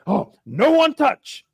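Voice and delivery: high, halting pidgin decree; hammed-up foreigner's grave prohibition; mock stern warning. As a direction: bark single words as if short on vocabulary. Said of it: "And he's like".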